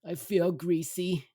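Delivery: slow, high mock-pitiful voice; queasy mother. Mother on the bench to her family, queasy. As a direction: imitate a mother miserably confessing. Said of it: "She then says".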